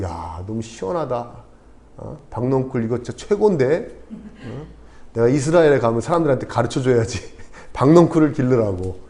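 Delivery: soft, low, contented Jonah musing to himself; pleased musing. Acted. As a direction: play Jonah quietly marvelling, voice dropped. 요나가 넝쿨에 감탄하는 연기. (나직이 혼잣말하며 흐뭇해하는 요나 연기)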